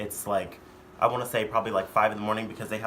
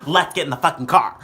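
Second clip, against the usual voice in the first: louder, impatient mom order; retold yelling; 3 semitones higher, 8 dB louder than usual. Mom ordering the guest into the car, yelling. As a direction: imitate a mom losing patience, louder. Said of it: "yelling at me".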